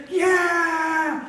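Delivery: high, loud cheering yell; crowd cheer. Retold or imitated. Kids answering the pastor's hype, mock cheer. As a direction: yell back like the whole crowd.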